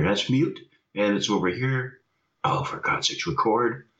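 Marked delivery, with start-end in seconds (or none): none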